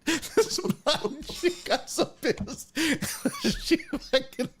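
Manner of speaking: slow, high words squeezed out through laughing; helpless laughter. Real, not acted. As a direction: try to speak while cracking up. Cracking up while telling the story.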